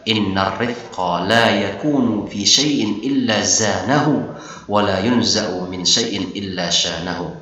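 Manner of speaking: slow, measured Arabic chant; hadith recitation. Acted. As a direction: recite the hadith slowly and melodically.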